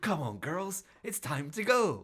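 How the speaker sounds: high, sing-out call to go; mock cheery rallying call. Acts out unbothered rescuer in film.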